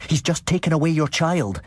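high alarmed call; yelled. A narrator's reading. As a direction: raise the alarm about the child. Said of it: "I yelled".

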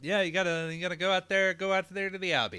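fast, loud auctioneer patter. Retold or imitated. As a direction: rattle it off like an auction caller. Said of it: "It's like an auctioneer".

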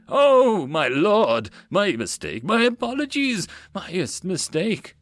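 high, fawning apology; changed, apologetic tone. Acted. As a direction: grovel to a lord, voice leaping up. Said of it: "quickly changed his tone".

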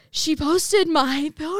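high, loud, drawn-out mock panic; imitating an overwhelmed, excited squeal; retold panic. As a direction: stretch it out like a freaked-out roommate. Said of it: "like, panicking".

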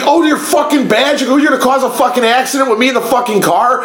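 high, indignant rant; retold hot anger. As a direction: re-enact chewing out a cop. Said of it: "I was so fucking hot".

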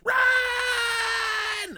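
high, loud squeaky yell; cartoon shout. Cartoon guy yelling.

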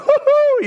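very high-pitched whoop of delight; joyful whoop. Retold or imitated. Wife pushed around the frozen lake, whooping. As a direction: whoop like you're on a ride. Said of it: "she had her arms out".

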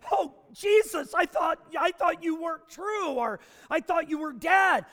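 high, loud startled cry; mock shock. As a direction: gasp it out like a caught man. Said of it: "He doesn't say".

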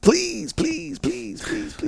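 very high, fading to a whisper; pleading. Shown as a demonstration. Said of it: "pleading thing".

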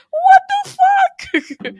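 a stunned high-pitched squeal; shocked squeal. Real, not acted. Host stunned by the plot, squealing in disbelief.

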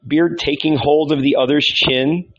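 plain reading voice

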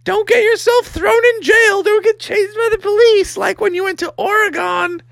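squeaky-high mom falsetto; hammed-up falsetto mother; storybook frog mom. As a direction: fuss after them in a very high mom voice. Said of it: "Froggy's mom was like".

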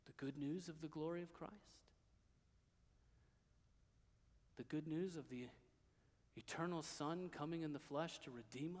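very soft, low, reverent sermon voice; hushed preaching. Real, not acted. Preacher telling the congregation the gospel, hushed and reverent preaching. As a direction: nearly whisper the good news to them.

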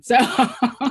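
laughing through the words, slowed. Real, not acted. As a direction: chuckle out the words at yourself. Laughs at her own answer.

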